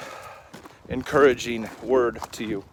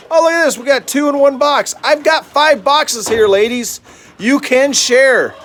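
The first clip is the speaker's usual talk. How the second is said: much higher and louder, coaxing the ladies; high, loud pet-talk scolding; excited talk to hens; 10 semitones higher, 7 dB louder than usual. Real, not acted. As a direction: gush at the hens, slowing on the last words. Talking to hens in nest boxes.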